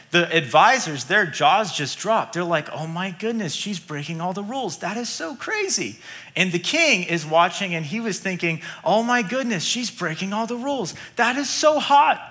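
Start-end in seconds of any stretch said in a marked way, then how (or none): 2.7-6.0 hushed, scandalised murmur of courtiers; softer, scandalised whisper-talk among advisors; shocked advisors. Acted. 8.8-12.2 higher, delighted and laughing at the end; gleeful king grinning through the last line; smitten king. Acted. King admiring Esther, amused.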